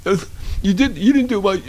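high silly royal voice, laughing at times; a dim prince fumbling excuses; mock Prince Andrew. Host plays Prince Andrew denying everything in a high voice. Caller voice returns.

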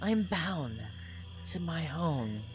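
the spirit answering the party from afar; mystical spirit voice. Acted. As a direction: speak as a spirit bound to its home. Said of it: "And the voice kinda, like, fades away".

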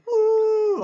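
high, loud "woo"; gleeful ride-down whoop. Acted. Game master acts out riding down, loud whoop.

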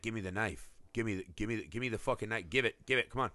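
low, soft, insistent repetition; imitated tense muttered pleading; urgent demand. Imitating a killer demanding the knife from his partner, hushed urgency.

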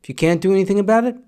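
ordinary talk

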